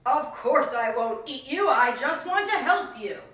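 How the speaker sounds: loud, false reassurance; sly fox. Acted. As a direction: pretend to be trustworthy.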